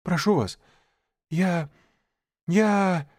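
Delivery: put-on high, breathless boy stammer; beg, tripping over words; stammering plea. A narrator's reading. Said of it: "заикаясь, выдохнул Маугли".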